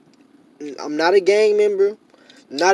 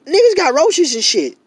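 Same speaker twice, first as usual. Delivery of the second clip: heated outburst; 9 semitones higher, 6 dB louder than usual. Real, not acted. Heated complaint about living conditions.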